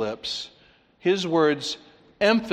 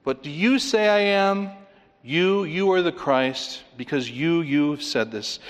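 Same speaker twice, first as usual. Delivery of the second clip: "you" lifted high, then repeated; emphatic YOU; 4 semitones higher than usual. Shown as a demonstration. Preacher replays question and answer, stressing "you". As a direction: lean hard on each "you". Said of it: "the emphatic words teach us".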